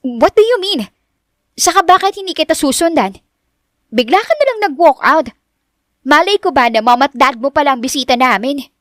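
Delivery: high-pitched, protesting Richelle voice; exasperated high retort; indignant young woman. Acted.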